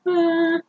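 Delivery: soft, high held hum; quiet sustained high tone by voice. Shown as a demonstration. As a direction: mimic a faint tinnitus pitch gently.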